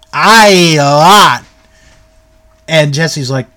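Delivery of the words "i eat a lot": slow, loud country wrestler impression; Uncle Elmer. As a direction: imitate a big hillbilly, drawing the words out. Voices Uncle Elmer answering slowly.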